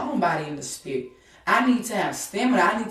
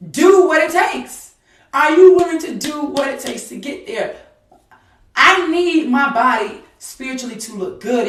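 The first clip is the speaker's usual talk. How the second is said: challenging preaching; 6 semitones higher, 8 dB louder than usual. Acted. Preaching at full force.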